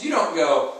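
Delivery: ordinary delivery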